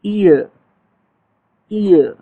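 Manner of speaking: ईयर said slowly, a pause after each; demo word. Shown as a demonstration. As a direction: model the word ईयर for students. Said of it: "हमारे पास word है जी ईयर".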